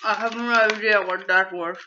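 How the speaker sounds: talking while chewing; words mumbled through a mouthful; mouth full. Real, not acted. Talking right after eating a bean.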